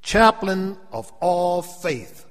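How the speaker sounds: high, loud, slow preaching. Real, not acted. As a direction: lift it like a preacher in full flow. Preaching builds.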